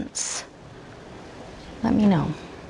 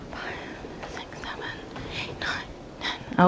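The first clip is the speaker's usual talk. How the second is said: soft unvoiced whispered count; whispering; 14 dB quieter than usual. Real, not acted. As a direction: count under your breath. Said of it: "whispered head count".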